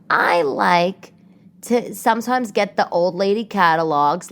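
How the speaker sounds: ordinary delivery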